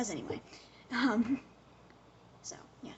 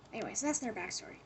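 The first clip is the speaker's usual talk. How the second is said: usual speaking voice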